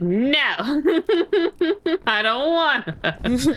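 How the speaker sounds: high, slow whiny refusal; whiny Spotify voice. Retold or imitated. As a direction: sulk like a bratty app. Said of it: "Spotify said".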